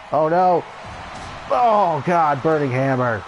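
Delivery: high, loud gasping cry; shocked excited outcry. Real, not acted. Shocking Burning Hammer move.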